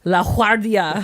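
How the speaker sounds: LA GUARDIA with an H-like GU, "la HWAR-dia"; affected Spanish-style pronunciation. Retold or imitated. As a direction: say La Guardia as "la HWAR-dee-a", overdone foreign pronunciation. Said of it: "tell me how he pronounces LaGuardia".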